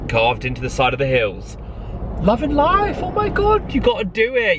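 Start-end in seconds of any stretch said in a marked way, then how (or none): 2.2-4.6 high-pitched burst of joy; exhilarated. Real, not acted. Solo traveller gushing about the trip, exhilarated. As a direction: gush it out, way up high.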